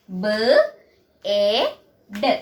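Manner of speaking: slow, low letter sounds with gaps; phonics sounding out. Shown as a demonstration. Teacher shows pupils how bed is pronounced, sounding it out slowly. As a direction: sound out "ബി എ ഡി" as separate, drawn-out sounds. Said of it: "pronunciation ശ്രദ്ധിക്കണം".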